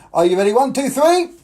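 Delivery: rising, shouted one two three; excited countdown. Acted. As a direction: count in high and loud to the kids.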